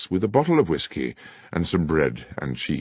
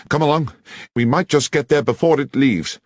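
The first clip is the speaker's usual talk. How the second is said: higher, louder call to hurry; urgent, raised summons; 9 semitones higher, 6 dB louder than usual. Acted. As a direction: rush your friend out the door. Holmes urgently rushing out.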